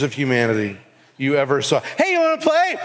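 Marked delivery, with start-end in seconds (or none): 1.8-2.8 high mock child calling out; kid voice. Preacher voices a kid asking friends to play, high and eager. Kids inviting other kids play.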